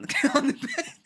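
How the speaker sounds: laughing through the words, pitch jumping way up. Real, not acted. To the stream about her shoes, laughing.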